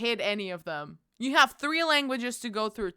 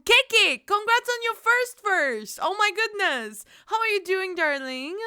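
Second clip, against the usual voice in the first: excited greeting; 7 semitones higher, 4 dB louder than usual. Real, not acted. Greeting chat with excited pitch.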